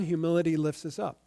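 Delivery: ordinary talk, nothing marked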